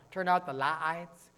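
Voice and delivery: LIGHTS drawn out slowly into two syllables; Southern two-syllable vowel. Retold or imitated. Preacher mimicking the Southern way with "lights", two-syllable drawl. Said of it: "Kim is a two-syllable word. So is light".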